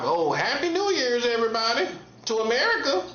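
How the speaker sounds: fake cheerful greeting. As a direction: chirp it like a phony well-wisher. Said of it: "on no fake vibe".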